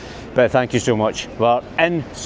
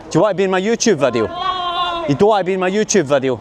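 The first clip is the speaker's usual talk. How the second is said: fast, higher, teasing and cheeky; annoying YouTuber; 6 semitones higher, faster than usual. Acted. Vlogger teasing girls on the street, quick and cheeky. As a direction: bug passers-by on purpose, quick and bright. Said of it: "I'm an annoying YouTuber".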